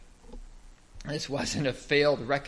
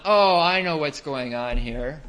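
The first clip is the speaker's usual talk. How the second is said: loud, drawn-out dawning realization; sudden realization; 8 dB louder than usual. Acted. Peter working out what Jesus is doing, in loud realization. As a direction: let the penny drop, loudly and slowly. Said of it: "Peter would have gone".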